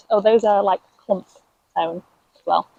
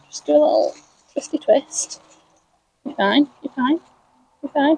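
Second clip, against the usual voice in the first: coaxing the plant; 5 semitones higher than usual. Real, not acted.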